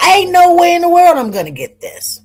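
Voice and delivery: high, loud disbelief; amazed outburst. Retold or imitated. Marvelling to viewers about the man she got, amazed and loud. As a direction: belt it out high, marvelling at your luck.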